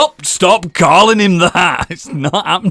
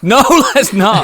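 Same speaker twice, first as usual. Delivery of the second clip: louder, higher laughing protest; laughing objection; 3 semitones higher, 5 dB louder than usual. Real, not acted. Player rejecting a silly name suggestion, laughing protest.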